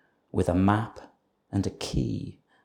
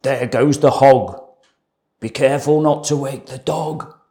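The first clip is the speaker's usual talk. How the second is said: thief voice; 3 semitones higher, 6 dB louder than usual. A narrator's reading. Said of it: "thieves speaking".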